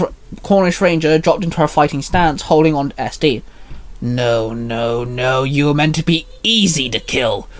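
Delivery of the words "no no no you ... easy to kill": snarling. A narrator's reading. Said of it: "Morgon Noir snarled".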